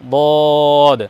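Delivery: বদ sounded with the tongue side on the back teeth; careful letter demo. Shown as a demonstration. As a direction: model বদ carefully for pupils. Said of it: "side লেগে উচ্চারণ হবে". (যত্ন করে বদ উচ্চারণ)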